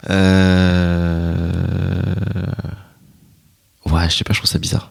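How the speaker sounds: throat catching, half-whispered; something in throat. Real, not acted. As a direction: speak past a tickle in the throat. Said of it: "j'ai un truc dans la gorge".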